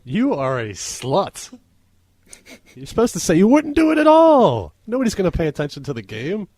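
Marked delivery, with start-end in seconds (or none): 0.0-4.7 mock outraged yell. Real, not acted. Teasing correction over podcast chat.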